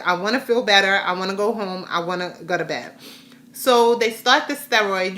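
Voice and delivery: normal talking voice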